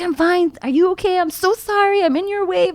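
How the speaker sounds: very high, retold fretful apology; frantic apology. Crash victim apologising to the trucker, high fretful babble. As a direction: pitch it way up, apologising in a hurry.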